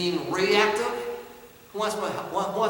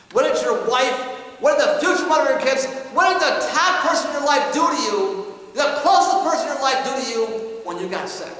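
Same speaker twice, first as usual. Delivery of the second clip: louder and higher, building fast and insistent; dad's hammering questions; 7 semitones higher, 8 dB louder than usual. Retold or imitated. Father confronting son about his wife leaving, loud insistent questions. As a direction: press each question harder.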